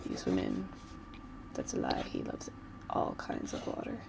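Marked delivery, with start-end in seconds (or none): 1.5-4.0 breathy whispered aside; whispering a little confession. Real, not acted. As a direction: drop to a secret whisper. Whispered aside while drawing.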